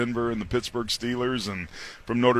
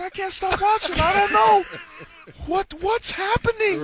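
mock distress; 3 dB louder than usual. Retelling his own reaction.

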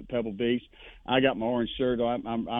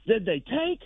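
retold yell; 9 semitones higher than usual. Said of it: "and he yells".

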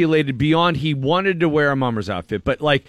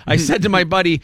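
ordinary talk

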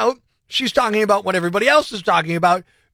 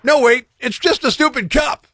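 hammed-up sarcastic commercial voice; mock ad read; 3 semitones higher than usual. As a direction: sell the cup like a mocking ad announcer. Sarcastic ad read over music.

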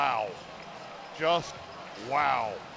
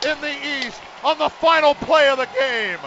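soaring shouted live call, much higher and louder; excited play-by-play; 10 semitones higher, 13 dB louder than usual. Real, not acted. Radio announcer calling the last-second kick at full shout. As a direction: ride the final play at full cry like a live game call.

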